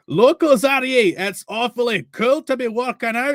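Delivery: put-on Scottish accent. Caravan man chatting up the boys, high Scottish accent. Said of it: "gotta go for Scottish".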